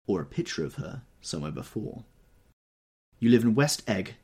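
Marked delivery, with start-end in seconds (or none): 3.2-4.2 contemptuous. A narrator's reading. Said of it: "she remarked contemptuously".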